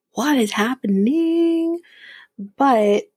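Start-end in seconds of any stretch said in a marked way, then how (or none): 0.1-1.8 high, drawn-out disbelief; bewildered. Real, not acted. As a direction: stretch it out high, totally baffled. Host bewildered by all the apologies.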